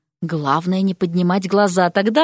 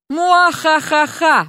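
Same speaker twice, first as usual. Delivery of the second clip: slow, higher-pitched mock evil laugh; villain cackle; 6 semitones higher, much slower than usual. A narrator's reading. Prank note written in place of student names, mock villain cackle. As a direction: read out a hammed-up, drawn-out evil cackle.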